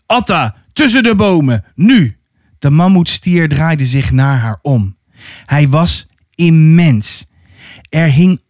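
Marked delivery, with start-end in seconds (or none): 0.0-2.2 urgent, punched command; calling out. Acted. Haran orders Atta into the trees, calling out urgently. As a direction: call it in short separate bursts, mostly pitched up. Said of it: "Haran riep".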